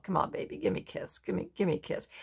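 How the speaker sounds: soft coaxing whisper; hushed sweet-talk; coaxing male bird. Acted. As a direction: whisper it to win her over. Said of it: "And he's like".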